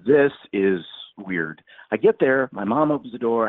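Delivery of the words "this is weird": drawn-out, slow puzzled remark; slow puzzled aside. Retold or imitated. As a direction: let it hang slowly, puzzled. Retelling his own puzzled thought.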